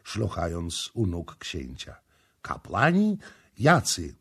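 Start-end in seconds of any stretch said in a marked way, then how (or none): 2.4-4.1 high, rising disbelief; incredulous, abrupt questioning; startled question. Acted. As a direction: query it sharply in a raised pitch. Prince startled by her revelation.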